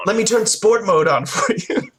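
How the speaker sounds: mock indulgent dad. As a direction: draw out the doting offer for laughs. Dad indulging his young son.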